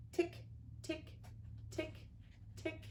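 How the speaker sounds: soft unvoiced tick tick; whispered clock ticks. Clock sound imitated.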